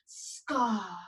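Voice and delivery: low villain growl of Scar; low menacing Scar. Shown as a demonstration. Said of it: "get low with your body".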